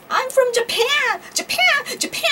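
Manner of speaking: Japan stretched very high and slow, stress on the first syllable; own old mispronunciation. Retold or imitated. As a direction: call out Japan! high and drawn out, JA-pan. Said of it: "って言いまくってたら". (昔の間違った発音で高く伸ばして Japan!)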